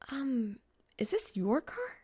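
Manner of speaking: hushed, unsure whisper. Acted. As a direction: breathe it out shyly. Timid question to stranger.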